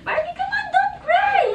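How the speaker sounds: high, sing-song coaxing with a laugh. Real, not acted. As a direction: cheer up the crying daughter. Mother coaxes crying daughter. (malambing na pag-alo, mataas na may tawa)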